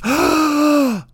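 sharp high shocked gasp; a mock crowd gasping. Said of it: "everybody goes".

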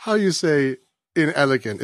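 lightly voiced cartoon German; German accent. Repeating the perfect Simpsons line, in a light German accent. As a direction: quote it with a mild German accent. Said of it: "that's Phil Hartman doing that voice".

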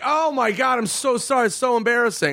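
high, gushing mock apology; over-apologise with feigned innocence; fake apology. Band fake-apologising to the guard.

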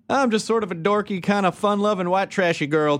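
high, lightly voiced ditzy girl; dorky girl voice. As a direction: play it up like a goofy teen. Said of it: "went from kinda like a".

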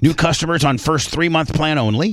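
loud, upbeat sponsor read; punchy host-read phone ad. Acted. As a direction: sell it like a radio spot. Reads a sponsor ad.

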